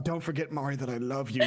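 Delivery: low imitated drunken sweet nothing; lightly acted besotted goodnight; drunk adoring papa. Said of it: "the last thing I said was like".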